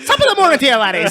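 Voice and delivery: loud, high stage Irish brogue; hammed-up Irish leprechaun greeting; Irish accent. Playing leprechaun after tiny homes.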